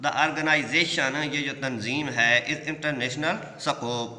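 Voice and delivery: each word drawn out slowly for the class; teacher reading a quote aloud slowly. Shown as a demonstration. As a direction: read it out slowly and clearly.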